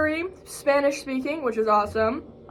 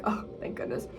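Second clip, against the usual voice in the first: breath out a retold sigh of relief; very soft, voiceless relieved aside; whispered relief; 12 dB quieter than usual. Retells relief that he was late.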